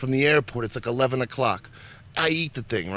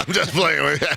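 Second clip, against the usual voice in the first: teasing through laughter; laughing; 4 semitones higher than usual. Real, not acted. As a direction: laugh while you tease. Laughing tease.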